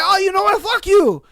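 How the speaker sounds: high, loud comeback; mock quarrel. Cook snapping back in kitchen fight, mock angry shout.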